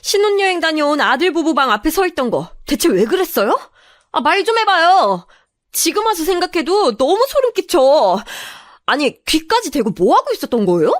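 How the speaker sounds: high, accusing interrogation; pent-up anger bursting. Acted. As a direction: demand answers, disgusted and furious. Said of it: "그동안 차올랐던 화가 차곡차곡 쌓여있다가 한꺼번에".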